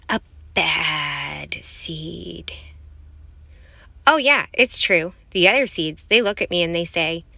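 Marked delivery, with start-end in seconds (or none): 0.1-2.5 very slow, hushed, lower voice; drawn-out menacing refrain. A narrator's reading. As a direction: stretch out each word slowly and softly. Dramatic refrain of villain narrator.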